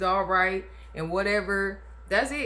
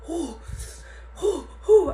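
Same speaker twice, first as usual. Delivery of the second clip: sharp high panting huffs through contractions; mock labor panting; labor breathing; 10 semitones higher than usual. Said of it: "I'm walking up there like this".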